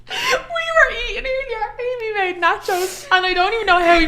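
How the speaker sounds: high wheezing laugh through words; wheezy laughing. Real, not acted. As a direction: wheeze with laughter while talking. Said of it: "Sorry, I'm so wheezy laughing today".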